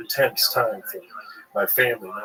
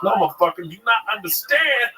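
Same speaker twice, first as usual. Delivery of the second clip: fired-up insistence; 13 semitones higher than usual. Retold or imitated. Boy telling his brother his destiny, hammed-up shout. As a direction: yell it like you've found your calling. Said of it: "I was like".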